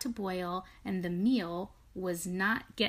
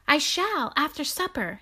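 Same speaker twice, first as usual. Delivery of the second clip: a firm, higher and louder piggy voice; decidedly; 7 semitones higher, 6 dB louder than usual. Acted. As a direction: settle it with no doubt. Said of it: "said Pigwig decidedly".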